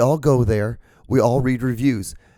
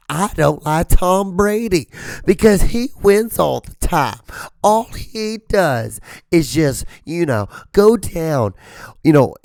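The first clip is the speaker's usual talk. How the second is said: whiny sports fan; 6 semitones higher, 4 dB louder than usual. Retold or imitated. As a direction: gripe about Tom Brady like a sore loser. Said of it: "They're like".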